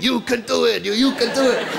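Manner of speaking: a crowd egging mum on; crowd cheering. Retold or imitated. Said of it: "people cheering".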